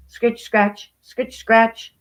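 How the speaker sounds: sharp onomatopoeic scratching; scratching sound words. A narrator's reading. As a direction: say it like claws on a door. Imitates dog scratching the door.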